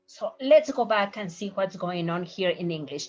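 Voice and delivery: ordinary delivery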